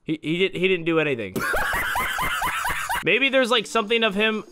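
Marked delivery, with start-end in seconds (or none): 1.4-3.0 high shrieking laugh; burst of laughter. Real, not acted. Character did nothing.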